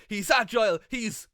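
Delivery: loud, eager play-by-play call; hyped commentator. Retold or imitated. Rookie commentator overhyping the match, loud and eager. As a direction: sell it like an overexcited rookie commentator. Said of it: "So I'd be like".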